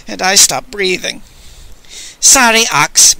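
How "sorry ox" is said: apologetic. Acted. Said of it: "saying apologetically".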